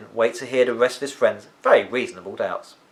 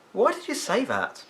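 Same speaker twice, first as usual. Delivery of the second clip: Fidelio's voice; 11 semitones higher than usual. Acted.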